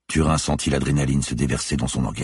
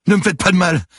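high, loud plea; frightened wail; 8 dB louder than usual. A narrator's reading. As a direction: beg in panic for mercy. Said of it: "gémit-il". (supplication gémie, aiguë et apeurée)

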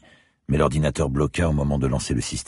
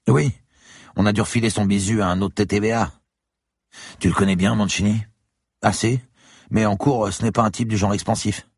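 put-on higher, young student's voice; 8 semitones higher, 3 dB louder than usual. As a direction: voice the eager student. Student character voice. (voix d'étudiant plus aiguë)

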